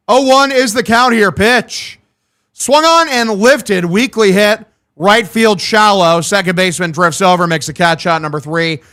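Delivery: a lightly voiced radio baseball call; a hint of a ballpark announcer; play-by-play call. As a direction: call the pitches like a broadcaster. Streamer calls the baseball game.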